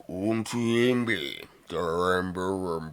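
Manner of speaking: low, hushed alien murmur; Jabba speaking Huttese. Acted. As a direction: confide softly in an alien tongue.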